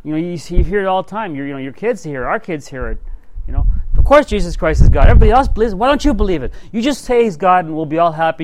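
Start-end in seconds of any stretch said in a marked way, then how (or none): 4.0-8.4 higher, louder mock of pushy believers; mocking peer pressure. Preacher mimicking people pressuring kids to believe, mocking and shrill-high. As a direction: parody the crowd insisting you conform.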